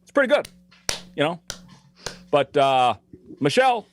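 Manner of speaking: paced like Obama, lead in and dismount; Obama impression. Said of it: "I can do an Obama".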